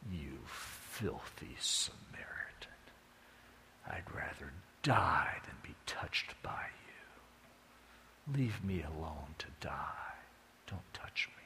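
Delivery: hissed, slow, low whisper of disgust; a wounded man whispering his scorn; contemptuous whisper. Acted. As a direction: spit the words out under your breath. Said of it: "he goes".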